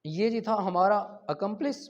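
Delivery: ordinary talk, nothing marked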